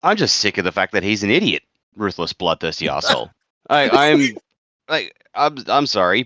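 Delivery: ordinary talk, nothing marked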